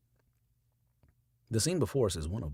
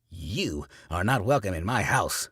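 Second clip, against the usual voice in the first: loud, furious ban; preacher shouting; 3 semitones higher, 7 dB louder than usual. A narrator's reading. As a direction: throw her out at full voice. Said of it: "Carey shouts".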